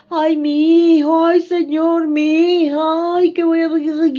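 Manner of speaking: high, loud wailing plea, a touch hammed; mock lament. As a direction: cry out like a worried parent. Acted parent's anguished lament.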